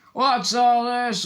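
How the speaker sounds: high, loud, drawn-out showman call; booming. A narrator's reading. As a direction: sweep in grandly to take the stage. Lockhart booming arrival.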